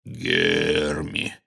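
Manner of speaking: low, drawn-out giant rumble; deep, lumbering creature voice; guttural rumble. A narrator's reading. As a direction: rumble it slowly from the belly like a giant. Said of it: "утробным голосом пророкотал".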